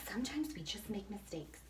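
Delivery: soft whisper; a sheepish whisper; whispered confession. Real, not acted. Confessing her mistake.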